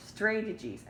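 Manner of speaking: "Strategies." loud, with a long A as in say; reading as spelled. Shown as a demonstration. Teacher echoing the pupil's long-A misreading. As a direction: echo the wrong reading back to them. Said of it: "That is exactly what it looks like".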